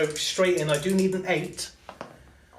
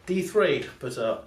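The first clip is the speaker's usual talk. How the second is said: ordinary talk, nothing marked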